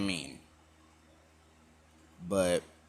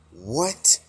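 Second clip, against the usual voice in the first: high, louder rising "What?"; stunned reaction; 4 semitones higher, 7 dB louder than usual. Retold or imitated. All the gods react to his familia, stunned. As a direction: blurt it out in disbelief.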